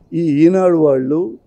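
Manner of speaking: usual speaking voice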